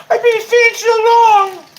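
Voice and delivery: very high, loud drawn-out wail; mock wailing. Streamer mimicking a banned fan, high mock wail. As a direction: mimic a whining fan at full volume. Mocks an angry banned viewer.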